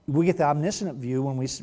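ordinary talk, nothing marked

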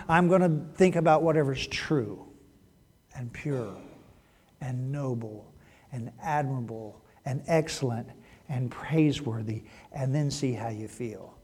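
soft sincere resolve; quiet resolve. Pretend. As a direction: draw them in softly. Quoting an intentional thought.

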